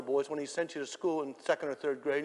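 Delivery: ordinary talk, nothing marked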